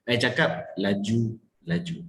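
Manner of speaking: slow "laju... laju" with a gap between; slowed, distinct words. Shown as a demonstration. Coach repeats the contrast, "laju-laju" slow and separated. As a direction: draw out each syllable of "laju-laju". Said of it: "I perlahankan sedikit supaya dia nampak".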